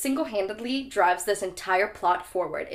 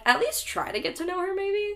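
very high, incredulous squeak; exasperated plea; 10 semitones higher than usual. Retold or imitated. Reader reacting to Harry's crush, high-pitched exasperation. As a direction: climb higher to the last word, pleading.

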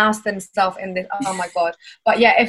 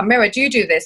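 ordinary talk, nothing marked

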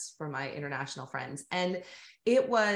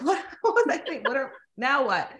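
high, loud, laughing what-now; mock panic; 6 semitones higher, 5 dB louder than usual. Guest retells her own dismay after college to the host, high and laughing. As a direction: replay the moment of realising the degree was useless.

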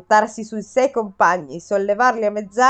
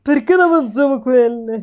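Giuseppe Conte impression. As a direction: drag out the words like a prime minister at a briefing. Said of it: "un po' come Giuseppe Conte".

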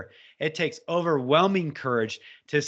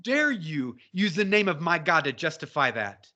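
usual speaking voice

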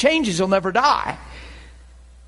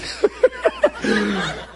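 high laughing shout. Real, not acted. Laughing at the memory.

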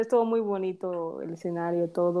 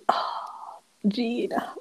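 breathy whispered "Ah, Gina"; whispered sigh; 3 semitones higher, 4 dB quieter, much slower than usual. Retold or imitated. Sigh of emotion over farewell.

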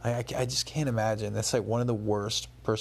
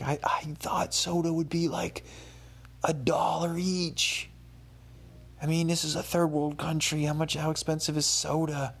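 high, airy put-on voice, breaking to a whisper on the price; playing the clueless foreigner; naive tourist voice; 7 semitones higher than usual. Telling friends about soda prices, in a high naive put-on voice. Mocking her own naive question.

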